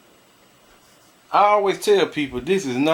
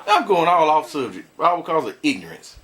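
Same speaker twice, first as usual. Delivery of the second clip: ordinary delivery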